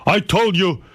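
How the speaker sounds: lightly voiced stern dad, slow and high; a hint of a stern father; imitating upset father. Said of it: "my father starts getting upset".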